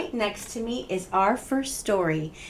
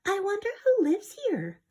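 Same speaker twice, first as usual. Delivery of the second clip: put-on high, quick whispered little girl; curious girl whisper; 10 semitones higher, 7 dB quieter than usual. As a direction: wonder aloud in a small girl's whisper. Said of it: "she said".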